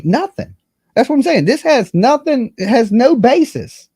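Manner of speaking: high, exasperated insistence; exasperated outburst. Real, not acted. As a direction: pitch it up in disbelief, hammering nothing. Exasperated rant about lawsuit.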